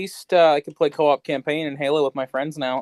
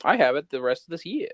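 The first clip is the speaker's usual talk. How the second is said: ordinary talk, nothing marked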